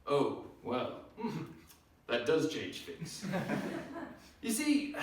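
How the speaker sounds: normal talking voice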